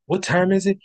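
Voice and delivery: ringmaster opening call; big announcer welcome to the show. Acted. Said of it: "announcer-style show opening".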